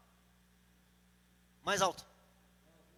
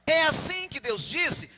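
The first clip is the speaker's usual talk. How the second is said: loud, raised, insinuating challenge; serpent calling Eve; 5 semitones higher, 9 dB louder, slower than usual. Acted. Serpent hails Eve to question God's order, loud drawn-out calling. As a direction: call out to her, then stretch the question. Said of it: "A serpente falou".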